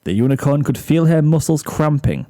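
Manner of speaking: ordinary delivery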